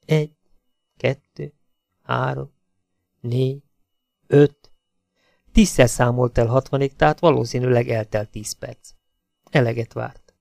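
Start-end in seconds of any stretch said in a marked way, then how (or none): 0.0-4.7 each number alone with long pauses; slow counting aloud. A narrator's reading. Hazel counting aloud to block her thoughts, slow. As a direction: count slowly to steady yourself. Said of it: "hangosan, lassan számolni kezdett".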